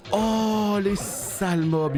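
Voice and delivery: sudden high yelp at the mobs, drawn out; startled outcry. Real, not acted. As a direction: cry out, cornered by monsters. Encerclé par des monstres. (cri surpris et aigu, encerclé par les monstres)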